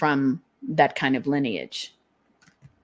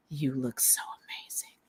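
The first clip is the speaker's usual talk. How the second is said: a secret admiring whisper; whispered compliment; 3 semitones lower, 13 dB quieter than usual. Retold or imitated. Lover whispering to you, whispered adoring compliment. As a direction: whisper it, soft and unvoiced.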